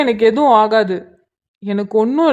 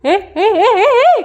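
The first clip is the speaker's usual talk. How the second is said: rapid high-pitched yells of alarm; alarmed shouts; 11 semitones higher, 4 dB louder than usual. Acted. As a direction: cry out in rising panic, much higher. Sudden alarm before the crash. (பதற்றத்தில் உரக்கக் கத்துவது)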